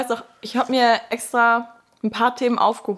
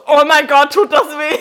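high, loud cry of mock pain; 6 semitones higher, 9 dB louder than usual. Telling her co-host about the painful procedure, a loud, high mock cry of pain. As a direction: wail it like it hurts badly.

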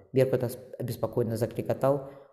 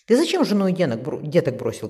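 raised, louder and higher reproach; scolding call; 5 semitones higher, 6 dB louder than usual. A narrator's reading. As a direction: call up in mild rebuke. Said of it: "крикнула ему Марика". (укоризненный окрик)

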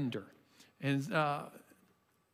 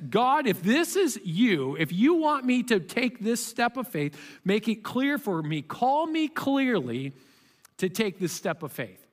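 slow, earnest plea to God; earnest prayer; 3 semitones higher than usual. Shown as a demonstration. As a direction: pray it slowly and sincerely. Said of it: "And it goes like this".

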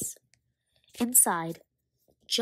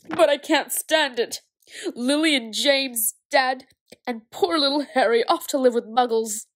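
tearful lament; 6 semitones higher, 9 dB louder than usual. Acted. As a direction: wail it like a heartbroken giant. Hagrid weeping over Harry.

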